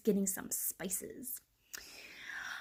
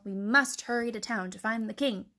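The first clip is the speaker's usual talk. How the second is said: ordinary delivery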